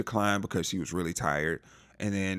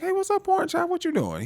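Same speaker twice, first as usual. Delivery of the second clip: very high, giddy imitated greeting; mock delighted greeting. Imitating Belinda greeting her admirer, giddy. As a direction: greet him in a hammed-up high voice.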